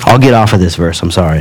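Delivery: normal talking voice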